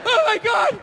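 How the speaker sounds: high, exasperated dieter's whine; hammed-up suffering over diet food; miserable dieter. Dieter turning down cake for salad, mock despair.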